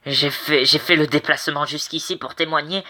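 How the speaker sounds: usual speaking voice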